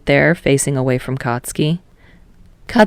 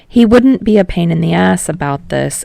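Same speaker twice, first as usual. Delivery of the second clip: plain reading voice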